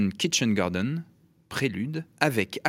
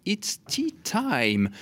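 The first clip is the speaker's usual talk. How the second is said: put-on It's tea time drawn out slowly and much higher, in a playful English accent; mock English accent; 9 semitones higher, much slower than usual. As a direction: play the English host announcing tea time. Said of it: "améliorer mon accent anglais". (accent anglais amusé, lent et haut perché)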